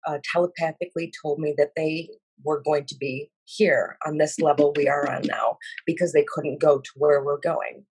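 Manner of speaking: ordinary delivery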